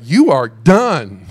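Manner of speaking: loud, slow pretend verdict; mock condemnation. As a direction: pass sentence loudly, drawing out each word. Imagines himself condemning Ahaz as God.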